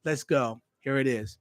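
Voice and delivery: ordinary talk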